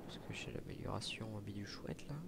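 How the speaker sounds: quick half-whispered aside to viewers. Real, not acted. To his viewers, a hushed whispered aside. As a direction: drop to a whisper for the joke.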